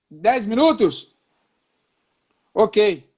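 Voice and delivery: calling out, trying to be heard; to someone hard of hearing. Real, not acted. Shouting into a failing call.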